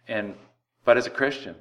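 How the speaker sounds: normal talking voice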